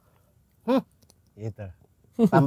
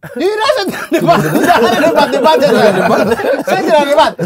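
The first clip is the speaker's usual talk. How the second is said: 1 laughing denial; 5 semitones higher, 6 dB louder than usual. Real, not acted. Denying he argued, laughing.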